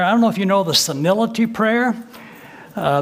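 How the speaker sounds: ordinary delivery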